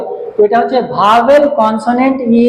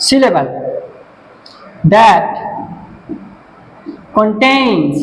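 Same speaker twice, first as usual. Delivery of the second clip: one word at a time with long gaps, some spelled out letter by letter; word-by-word dictation; 3 semitones lower, much slower than usual. Shown as a demonstration. Teacher writing on the board and dictating word by word, slow. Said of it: "এর সংজ্ঞাটা বলে দিচ্ছি".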